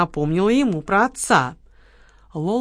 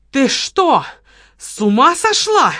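loud, higher, slowing indignant yell; shouting out; 6 semitones higher, 5 dB louder, slower than usual. A narrator's reading. Kamila, coffee spilled on her, yells at Lola. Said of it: "выкрикнула Камила".

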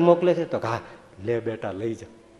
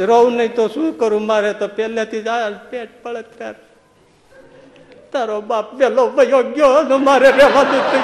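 put-on higher, wailing old mother voice with laughs; mock crying mother; 8 semitones higher, 6 dB louder than usual. Widowed mother cries to her son: higher, mock wailing. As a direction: lament like a poor old widow, hammed up. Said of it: "રોવા માંડે".

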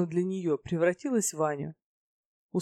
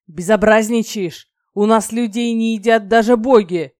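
very loud, raised-pitch telling-off; angry scolding; 4 semitones higher, 12 dB louder than usual. A narrator's reading. Dragon rebuking a goddess who threatens to eat people, loud scolding. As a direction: scold her at full volume.

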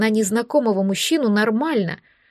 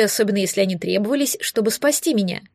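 ordinary delivery, nothing marked

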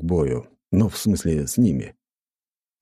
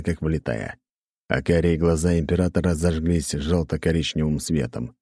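usual speaking voice

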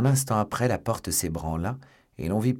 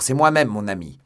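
loud, hearty reply; raised voice; 9 dB louder than usual. A narrator's reading. D'Artagnan identifies himself to Athos, voice raised. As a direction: call back to a friend. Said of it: "en haussant la voix".

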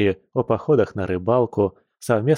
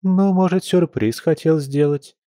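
insinuating; 7 semitones higher than usual. Acted. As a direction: coax it out with a sly hint. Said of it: "вкратчиво произнесла Ольга".

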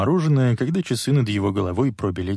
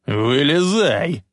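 long drawn-out, higher command; bully's yell; 5 semitones higher, 3 dB louder, much slower than usual. A narrator's reading. Drunk bully orders boy out of car, mild drawn-out yell. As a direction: stretch the order out like a yelling bully. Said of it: "заорал Биф".

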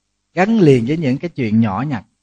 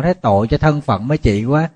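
normal talking voice

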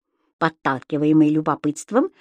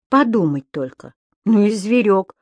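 ordinary delivery, nothing marked

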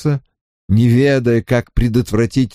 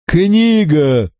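prophetic cry; 6 semitones higher than usual. A narrator's reading. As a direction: call it out like a prophecy. Vagrant blurts strange answer.

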